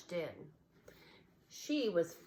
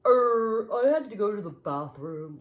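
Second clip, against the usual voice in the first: louder, odd toy bear voice caught out; ceramic bear voice; 3 semitones higher, 11 dB louder than usual. A narrator's reading. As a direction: fumble an excuse like a toy bear. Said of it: "said Bartholomew".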